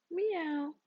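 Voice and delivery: high sleepy meow; cat meow. A narrator's reading. The cat Beatrice answering Mister Goat with a high meow. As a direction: mew like a lazy cat. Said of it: "Beatrice said, and yawned".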